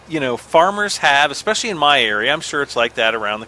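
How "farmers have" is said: ordinary talk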